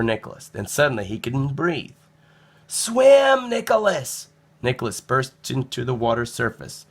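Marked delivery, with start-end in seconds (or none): 2.7-4.3 high, loud cry to swim; urgent high shout; urgent call. A narrator's reading. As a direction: shout it over the waves.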